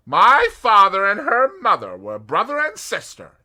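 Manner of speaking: precise, loud American voice, every word placed; meticulous American. A narrator's reading. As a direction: spell it out carefully. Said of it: "explained Mister Hersheimer meticulously".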